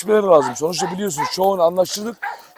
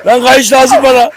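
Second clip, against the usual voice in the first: higher, loud yell over the leash; loud shout; 5 semitones higher, 8 dB louder than usual. Real, not acted. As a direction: yell at the dog to let go. Struggling with dog for leash.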